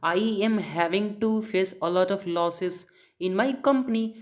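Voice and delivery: very slow, word by word English; slow English translation. Shown as a demonstration. Teacher slowly translating the example for students into English. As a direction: spell out the English sentence slowly. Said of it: "यानी कि".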